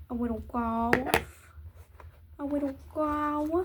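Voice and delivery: "little" softened to "widdle", "goth" drawn out and rising; playful baby talk. Pretend. Repeats phrase in playful baby talk.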